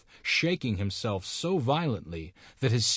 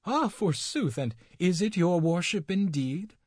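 put-on high, surprised old woman's cry; a hint of a startled crone; old dame crying out; 8 semitones higher than usual. A narrator's reading. Old dame greeting the devil, lightly voiced surprised crone. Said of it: "cried the good dame".

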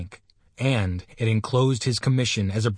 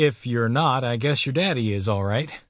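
usual speaking voice

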